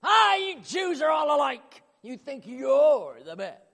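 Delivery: loud, high, offended outburst; indignant shout. Pretend. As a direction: hurl it back in mock outrage. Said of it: "if she comes back and says".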